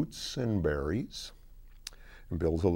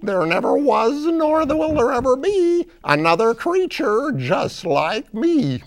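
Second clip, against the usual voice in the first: high-pitched singsong boast; Bakito; 10 dB louder than usual. Acted. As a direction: proclaim it as a one-off cartoon creature. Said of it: "they hear somebody saying".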